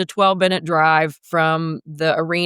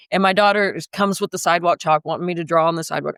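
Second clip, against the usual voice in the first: ordinary talk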